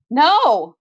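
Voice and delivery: a loud cry of refusal; screaming. A narrator's reading. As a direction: scream it again. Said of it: "I scream again".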